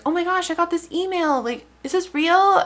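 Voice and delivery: high-pitched mock wide-eyed wonder; hammed-up high, hopeful voice; naive excitement. Acts out excited email recipients.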